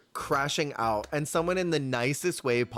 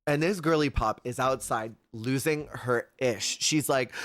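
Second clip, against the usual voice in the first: usual speaking voice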